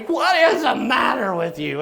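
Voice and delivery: high, loud mock scolding; mad wife. Wife mad at husband for scaring her, angry scolding. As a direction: imitate an angry wife's yell. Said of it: "now she's mad at me".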